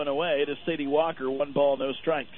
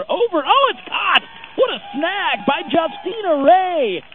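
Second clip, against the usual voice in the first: loud, high shout of amazement; excited catch call; 12 semitones higher, 9 dB louder, slower than usual. Real, not acted. Announcer to listeners as third baseman snags looper, excited shout. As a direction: cry out the spectacular grab, stretching the words.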